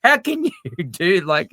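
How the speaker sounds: high, loud laughing disbelief; incredulous. Retold or imitated. Retelling amazement at prediction.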